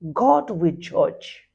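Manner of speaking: "God will judge" drawn out very slowly; grave, slow warning; slow solemn warning. Real, not acted. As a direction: pronounce it like a verdict, slowly. Solemn warning after rant.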